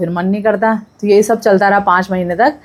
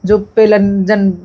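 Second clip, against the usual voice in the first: usual speaking voice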